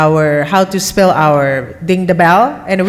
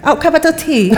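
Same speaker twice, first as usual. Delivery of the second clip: higher-pitched mock-British delight, laughing; British accent; 7 semitones higher than usual. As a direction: echo it in a playful British accent. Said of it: "I love the British accent".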